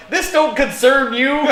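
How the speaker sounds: high, loud mock telling-off; hammed-up indignant yell; defensive shout. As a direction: shout them back to their own business. Tells off staring clerics.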